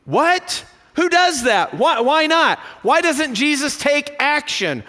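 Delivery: high, disbelieving what, who does that; incredulous questioning, pitched high; baffled protest. Retold or imitated. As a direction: voice the listener's outraged confusion. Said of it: "And we're going".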